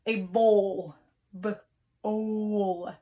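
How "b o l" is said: B-O-L spelled letter by letter; B-O-L を一文字ずつ; spelling out the word. Shown as a demonstration. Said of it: "What long vowel do we hear".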